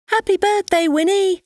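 loud, high, cheerful group shout. A narrator's reading. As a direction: shout it out joyfully at a party. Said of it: "they shouted".

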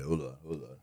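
usual speaking voice

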